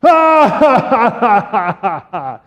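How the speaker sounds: high, loud hammed-up ha ha fading out; hammed-up villain laugh; giant's mocking laugh. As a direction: boom out a giant's scornful laugh. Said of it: "starts to laugh".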